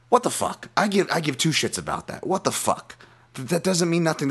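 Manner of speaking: high, exasperated outburst; real frustration boiling over at a movie; heated rant. Real, not acted. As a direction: vent like you can't believe it. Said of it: "That is my rant".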